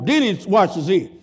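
ordinary talk, nothing marked

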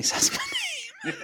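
laughing through the words. Real, not acted. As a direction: crack up while saying it. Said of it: "I'm sorry".

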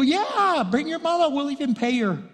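high, loud, excited yes; acted-out delighted acceptance; eager agreement. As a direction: jump at the offer, voice leaping up. Acting out the eager reply to Miriam.

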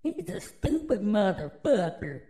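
usual speaking voice

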